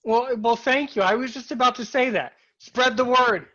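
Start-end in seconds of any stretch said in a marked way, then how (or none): 2.6-3.5 loud, high slogan delivery; rallying call. Acted. Host echoing guest's plea to listeners, rallying call.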